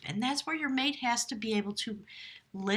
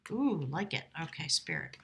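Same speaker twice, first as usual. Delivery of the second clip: ordinary delivery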